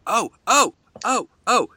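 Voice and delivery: excited. Real, not acted. Teasing friend's excitement.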